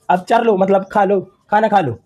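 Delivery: ordinary delivery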